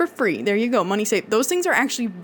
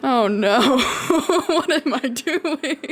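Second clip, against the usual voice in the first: laughing; 7 semitones higher, much slower than usual. Real, not acted. Flustered after game mishap.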